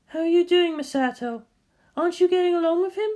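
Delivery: high, lively woman's voice; bright teasing guardian; Misato voice. Acted. Misato questions Shinji at the target, high lively voice.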